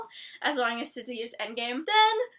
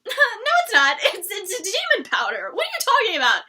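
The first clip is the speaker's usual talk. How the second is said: laughing exasperation; 6 semitones higher, 6 dB louder than usual. Real, not acted. Mocking the show's vampire venom line.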